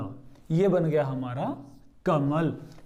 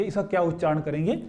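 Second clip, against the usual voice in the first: ordinary delivery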